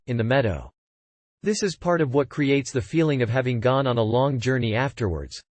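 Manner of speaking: ordinary delivery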